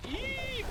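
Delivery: very high squeaked shriek; tiny high-pitched mock scream; cartoon "eek". Shown as a demonstration. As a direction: squeal it like a startled cartoon character. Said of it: "act like a cartoon character".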